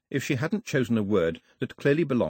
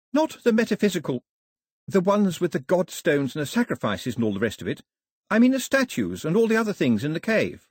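put-on high, insistent girl's voice; a girl trying to make herself understood; high-pitched young heroine, correcting him; Daphne, explaining; 8 semitones higher, 3 dB louder than usual. Narrator voices Daphne explaining hurriedly.

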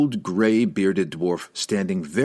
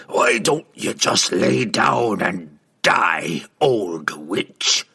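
gravelly dwarf ghost; 3 dB louder, much slower than usual. Acted.

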